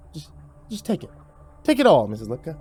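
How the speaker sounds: ordinary delivery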